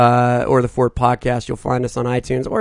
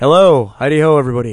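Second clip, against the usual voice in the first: put-on intro voice; 7 dB louder than usual. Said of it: "sounded a lot like mine".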